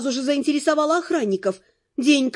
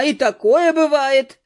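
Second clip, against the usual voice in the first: 1 shrugging; 4 dB louder, slower than usual. A narrator's reading. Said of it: "развела руками девушка".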